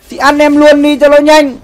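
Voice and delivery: mock pleading. Acted line to older woman.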